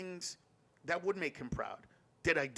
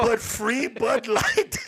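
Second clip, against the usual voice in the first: mock outrage; 8 semitones higher, 12 dB louder, much slower than usual.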